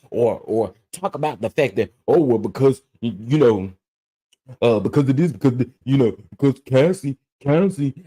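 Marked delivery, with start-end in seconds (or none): 2.1-8.0 lightly mimicking clueless, stumbling critics; mock dumb arguing. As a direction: stammer through a mocking imitation of a weak argument. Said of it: "y'all sitting there sounding so dumb".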